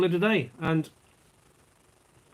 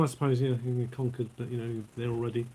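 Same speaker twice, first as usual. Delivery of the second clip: ordinary talk, nothing marked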